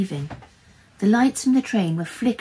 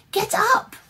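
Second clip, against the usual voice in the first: very high, urgent cry; panicked high yell; desperate scream; 7 dB louder than usual. A narrator's reading. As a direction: cry it out frantically at top pitch. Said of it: "she screamed".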